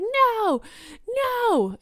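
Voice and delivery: high, drawn-out mock toddler cry; imitated child's screaming. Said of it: "he's, like, screaming no".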